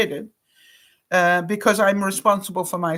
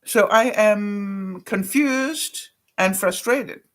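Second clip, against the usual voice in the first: slow and deliberate; much slower than usual. Retold or imitated. Retold complaint to denturist.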